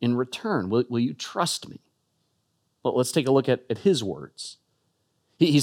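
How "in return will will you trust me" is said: soft, slow, tender invitation; kind and loving. Acted. Jesus gently asking his followers for trust, softly tender. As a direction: ask gently, like a loving friend. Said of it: "with a kindness, with a generosity, with a love".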